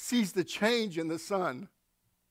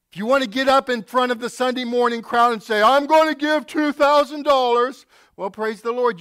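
louder, higher mock boast; preaching loud; 7 semitones higher, 10 dB louder than usual. Pastor mocking showy givers, loud. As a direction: ham up the showy donor.

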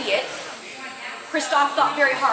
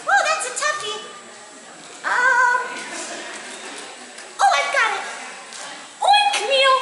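high, bright-eyed little bunny, pleased with itself; tiny bunny voice; 12 semitones higher than usual. Acted. Little bunny Sword answering Kristoff's riddle, squeaky and proud.

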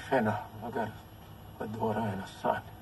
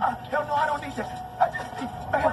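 high, frightened protest; panicked refusal. Acted. Patient fending off the woman's root work, panicked.

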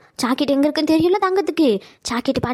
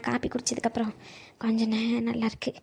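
weak and faint; 2 semitones lower, 10 dB quieter than usual. Acted. Sick grandmother answering weakly.

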